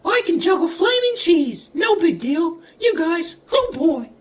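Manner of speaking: piercingly high falsetto cartoon mouse; Mickey Mouse. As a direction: chirp it like a cartoon mascot. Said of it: "Mickey Mouse".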